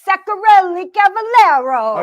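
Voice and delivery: loud, high, hammed-up European accent. Host trying the guest's surname in a playful European accent. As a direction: roll out the surname in a grand mock-European accent. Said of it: "you do it with European".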